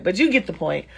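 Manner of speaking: ordinary talk, nothing marked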